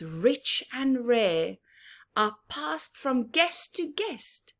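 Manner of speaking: slow, lilting storybook verse; sing-song picture book reading; reading a rhyme aloud. Acted. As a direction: savour each line for the children. Reading rhyming verse to children.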